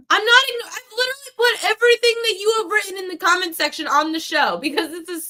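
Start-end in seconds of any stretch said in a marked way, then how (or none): 0.1-3.0 louder, higher, cutting in; heated outburst. Real, not acted. Host firing back at a chat troll, heated and loud. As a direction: snap back at the commenter.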